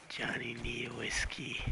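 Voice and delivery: whispered, no voice; whispered plea. Acted. Johnny begging for whiskey in a whisper. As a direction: hiss the begging softly.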